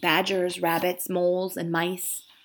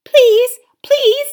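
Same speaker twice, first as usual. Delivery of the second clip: much higher, loud timid little lamb; 10 dB louder than usual. Acted. Young lamb daring to speak up, in a high voice. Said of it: "said the high voice of a woolly lamb".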